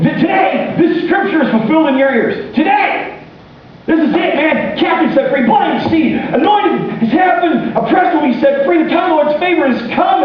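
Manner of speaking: Jesus announcing fulfilled scripture in a high shouted proclamation; Jesus proclaiming. Acted. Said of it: "and he says to him".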